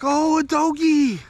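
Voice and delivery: put-on high, slow and sweet like a small child; little girl voice. Little girl asks her mommy to pet the dog, high and slow. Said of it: "the little girl's like".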